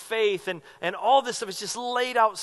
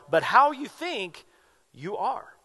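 slow "but how you think", then "you are" set apart; pause before "you are"; slower than usual. Shown as a demonstration. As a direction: lean hard on "how", break at the comma before "you are". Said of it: "There's a comma in there".

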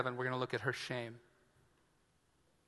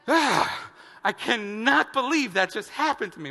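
exasperated; 8 semitones higher, 6 dB louder than usual. Retold or imitated. Sitting down after the ordeal.

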